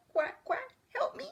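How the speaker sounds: very high, small voice; frightened duckling cry. A narrator's reading. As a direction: squeak for help like a baby duck. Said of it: "cried number five".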